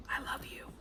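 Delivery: breathy whispered "I love you"; whispered vow. Retold or imitated. Mocking Lizzie's vows to her king, whispered. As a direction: breathe it like a sultry secret. End of mocked wedding vows.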